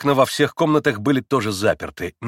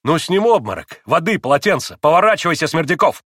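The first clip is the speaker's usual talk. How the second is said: loud, raised commands; urgent orders; 5 semitones higher, 5 dB louder than usual. A narrator's reading. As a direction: shout for help over a fainted man. Urgent orders as man faints.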